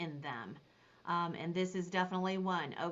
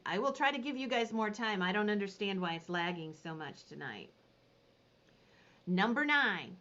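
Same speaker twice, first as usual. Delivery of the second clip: ordinary delivery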